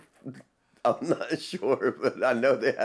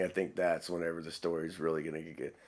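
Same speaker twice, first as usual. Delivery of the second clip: ordinary talk, nothing marked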